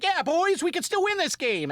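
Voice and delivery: high-pitched hopeful cheer; rally the boys, high and eager; excited rallying cry. Acted. Host voicing the team's lift after a home run, excited rallying cry. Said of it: "it was like a shot in the arm".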